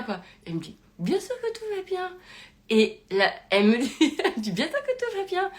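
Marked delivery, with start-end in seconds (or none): 1.0-2.1 lilting, carefree mock reassurance; breezy reassurance. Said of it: "hyper, euh, marrante, calme, posée". 4.5-5.5 high sing-song imitation of an unfazed friend; airy, untroubled mock reassurance; breezy reassurance. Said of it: "hyper, euh, marrante, calme, posée".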